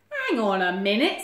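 loud, mildly indignant protest; a lightly voiced flustered deer; spluttering Deer. A narrator's reading. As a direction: blurt out a loud objection. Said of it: "spluttered Deer".

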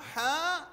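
حاء with a long, high-pitched open aa; long alif vowel. Shown as a demonstration. Teacher showing pupils the open letter, drawn-out vowel. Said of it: "نلاحظ النطق مرة أخرى".

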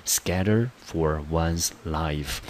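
slow, careful Scatter for one's life; slow model phrase. Shown as a demonstration. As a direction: lay the phrase out slowly. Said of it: "我们就可以把它翻".

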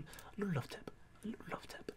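breathy hushed whisper; soft whisper. Pretend. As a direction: whisper it gently, like a caress. Gentle tap on bell icon.

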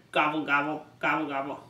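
low, even run of gobbles; turkey gobble. Acted. Parent showing what a turkey says, low gobbling. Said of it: "This is a turkey. It says".

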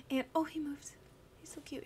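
whisper. Real, not acted. Owner whispering as the dog moves behind her. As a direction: keep it hushed for the dog.

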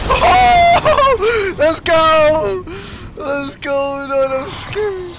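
loud, high excited yelling; excited shout. Real, not acted. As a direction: cheer it out at the top of your voice. Big moment in the game.

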